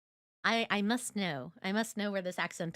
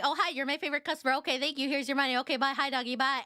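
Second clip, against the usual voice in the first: rushed mimicry; 3 semitones higher, faster than usual. Mocking a movie's flower-shop scene that goes by so fast, rushed. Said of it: "it just goes by so fast".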